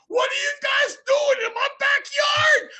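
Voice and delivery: very high, loud angry shout; angry yelling. Retold or imitated. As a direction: yell at intruders in a bathrobe. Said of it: "starts yelling at us".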